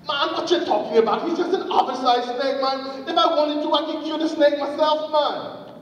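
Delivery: high-pitched, dismissive arguing; staged scoffing. Acted. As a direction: scoff back in a mock quarrel. Doubter in the staged argument.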